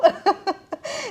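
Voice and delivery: high-pitched amused laugh; real high chuckling. Real, not acted. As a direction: laugh at your own anecdote. Laughing at own anecdote.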